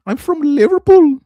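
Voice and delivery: high, loud mock Scouse accent; a loud, high Scouse parody of a girlfriend; mock Scouse girl. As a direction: squeal it like a caricatured Liverpool girl.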